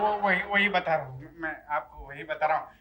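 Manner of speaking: low, soft stammering; flustered. Acted. Builder placates the strongman demanding payment, flustered. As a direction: stumble over it quietly, pitched low. Nervous negotiator answers demand for price.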